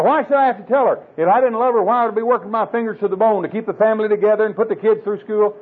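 high, indignant husband voice; hammed-up husband complaining, high; mock husband excuse. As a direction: sound put-upon and defensive.

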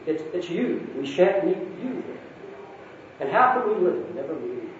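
lightly voiced tearful girl; mild sobbing. A narrator's reading. Lucy crying to Aslan she'll never meet him, mild sob. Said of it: "sobbed Lucy".

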